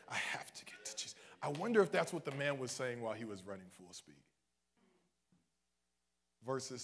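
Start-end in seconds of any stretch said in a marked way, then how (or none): 0.1-1.1 whispered desperate repeat; urgent whisper. Acted. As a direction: whisper it like a man straining toward Jesus. Voicing the man's desperate thought.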